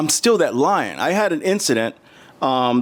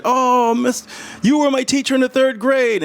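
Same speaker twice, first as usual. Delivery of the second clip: higher, bright-eyed student greeting, lightly voiced; excited student; 9 semitones higher than usual. Former student greeting his third grade teacher, lightly excited and higher. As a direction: beam like a kid spotting an old teacher.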